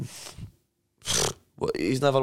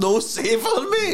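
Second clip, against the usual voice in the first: laughing; 12 semitones higher, slower than usual. Real, not acted. Mocking the misheard word.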